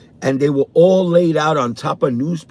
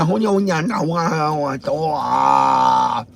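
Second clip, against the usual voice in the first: imitating her, trailing into slow nonsense syllables; lightly voiced babble, slowing at the end; mock foreign gibberish; slower than usual. Imitating the neighbor's reply, mock gibberish slowing down. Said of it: "I don't know what the fuck she was saying".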